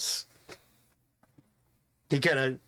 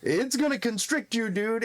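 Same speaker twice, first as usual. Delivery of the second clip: alarmed; 8 semitones higher than usual. Acted. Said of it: "and you're like".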